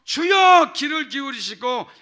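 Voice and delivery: each Lord shouted high and loud; triple cry of Lord. Shown as a demonstration. Pastor demonstrates the threefold cry of Lord, shouting. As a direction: cry out three times to God. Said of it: "이게 주여 삼창이에요".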